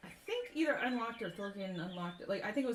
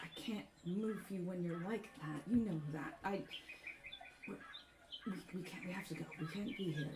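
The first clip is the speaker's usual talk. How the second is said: hushed plea; 8 dB quieter than usual. Acted. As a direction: plead quietly, stumbling over the words. Urgent plea to confused brother.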